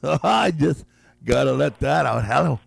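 ordinary talk

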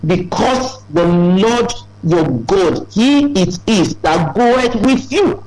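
slow, measured reading of scripture; solemn slow quote. Retold or imitated. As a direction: lay out each word slowly like holy writ. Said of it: "He says".

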